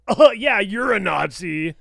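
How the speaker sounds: loud start, slowing into the jab; loud, drawn-out mock retort; mock accusation. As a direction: throw it out loudly, mockingly. Acted reaction to online insult.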